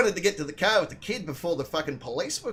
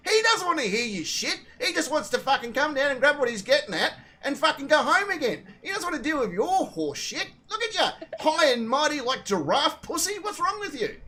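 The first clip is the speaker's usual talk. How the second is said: high-pitched indignant rant; losing it, retold; 9 semitones higher than usual. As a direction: rant at her as if losing your temper, retold for laughs. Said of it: "I'm starting to lose it at this lady".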